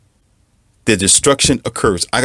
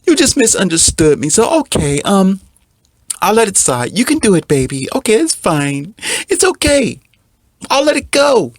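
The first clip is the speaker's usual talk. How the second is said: mocking a weak man; 10 semitones higher than usual. Mocking the blue pill man forgiving his girlfriend, high whine. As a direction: ham up the spineless boyfriend giving in. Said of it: "That's him talking".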